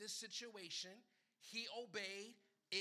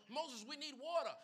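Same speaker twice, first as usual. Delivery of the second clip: people demanding water; 6 semitones higher, 7 dB louder than usual. Retold or imitated. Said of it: "I'm looking at people".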